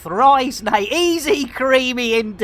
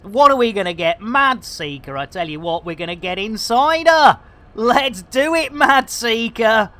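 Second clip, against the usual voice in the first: ordinary delivery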